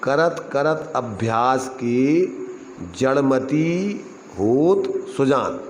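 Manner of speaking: very slow, phrase by phrase, with होत stretched out; deliberate verse demonstration. Shown as a demonstration. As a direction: draw out each phrase of the verse, pause after अभ्यास की. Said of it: "अर्थ पहले सुनो ध्यान से". (बहुत धीरे, टुकड़ों में दोहा)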